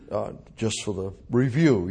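ordinary talk

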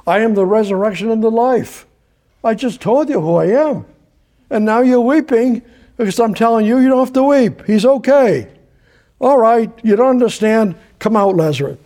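Jesus pleading. Retold or imitated. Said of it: "he had just told them".

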